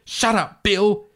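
high, loud snap at her husband; angry retort. Acted. Mother on the tape snapping at her husband to shut up. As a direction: cut him off sharply.

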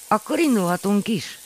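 ready to set off, eager; eager question. A narrator's reading. Eager question from Manó apó. (lelkes kérdés)

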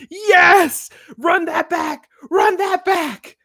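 high shouted cheers, slowing down; excited shout. Retold or imitated. Fan cheering on the TV, shouting to replay it. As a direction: yell for the scene to be replayed.

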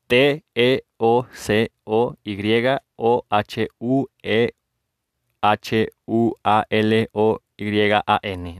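slow, separated letter names; spelling aloud. Shown as a demonstration. Host spelling a difficult name for listeners, letter by letter. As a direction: dictate it letter by letter. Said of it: "se los voy a deletrear".

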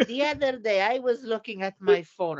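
light mock mother voice; mimicking the mother. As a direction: play the chatty mother.

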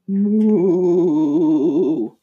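drawn-out demo moo; playful cow sound. Shown as a demonstration. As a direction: moo like a cow for the little one. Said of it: "Cow says".

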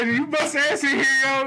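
loud startled call at her in the car; calling out Angie. Retold or imitated. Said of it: "I was like".

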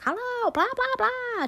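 high mock American gush; loud American tourist. Mimicking the loud American customer in the shop, high mock gush. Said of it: "talking very loud and very American".

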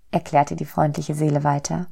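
ordinary delivery

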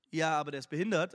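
lightly voiced low, quick objection; dismissive objection. Onlookers answering King David's offer, mildly dismissive.

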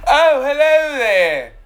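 high, loud, drawn-out hello; surprised greeting. Real, not acted. Host spots the cat walking around, surprised greeting. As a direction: sing out a slow, delighted-to-see-you hello.

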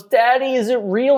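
a small son asking from his bed; imitating a small child. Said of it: "he looks up at you and goes".

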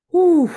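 loud, high "whew"; forceful whew. Real, not acted. Coach pausing mid pep talk, forceful whew. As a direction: blow out a big whew. Exhales amid intense buildup.

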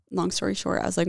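ordinary talk

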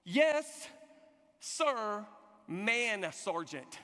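each word set apart by pauses; slow, deliberate mock military answer; recruit's response. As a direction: hold back, then land each word. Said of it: "my son responds with".

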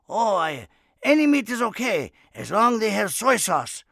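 loud, high-pitched Japanese accent impression; hammed-up Japanese accent, shouted and high; mock Japanese accent. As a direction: answer as an exuberant Japanese man. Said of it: "He was Japanese".

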